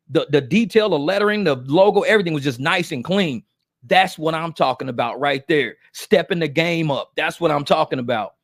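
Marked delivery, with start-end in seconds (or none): none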